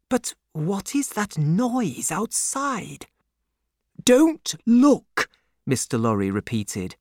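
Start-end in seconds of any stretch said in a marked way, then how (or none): 0.1-3.1 high, slow, wondering question; uneasy question. A narrator's reading. Doctor hears noise outside, asking uneasily. 4.0-5.3 high, loud, urgent command; urgent warning. A narrator's reading. Banker repeats warning to doctor, urgently.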